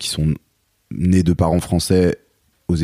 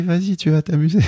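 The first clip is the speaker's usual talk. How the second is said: put-on high, bright pep talk to a toddler; sing-song parent coaxing; mock cheerful encouragement; 13 semitones higher than usual. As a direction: push the kid in with fake enthusiasm. Parents cheerfully sending son to childcare.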